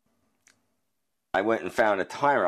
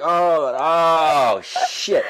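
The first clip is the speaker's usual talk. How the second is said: high, loud, breathless realization; dawning surprise; 10 semitones higher, 7 dB louder, much slower than usual. Retold or imitated. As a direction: it hits you mid-word. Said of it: "I'm like".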